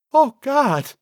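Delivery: high, loud sidekick cry; horrified. Acted. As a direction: recoil with a high-pitched cry. Cecil recoils in horror.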